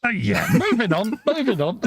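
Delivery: high, sing-song dodge of the topic; hurried high-pitched change of subject; awkward moving on. Real, not acted. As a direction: brush it aside with a giggle.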